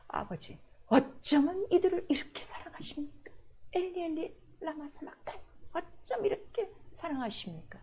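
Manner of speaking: soft, whispered plea; hushed prayer. Acted. Jesus praying to the Father, whispered and tender. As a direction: pour it out under your breath.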